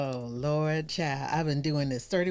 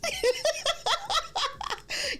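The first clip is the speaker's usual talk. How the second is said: very high, giggly cackle; real laughing fit. Real, not acted. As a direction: burst out laughing, high and rapid. Laughs at her own joke.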